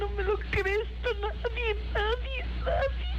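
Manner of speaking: crying, pitch rising high; sobbing in terror. Real, not acted. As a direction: sob out the panic.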